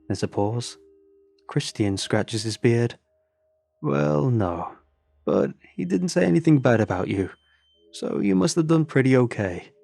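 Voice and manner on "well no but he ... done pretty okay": put-on higher, breezy Norwegian accent; light Norwegian reassurance. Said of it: "The accent ... Norwegian".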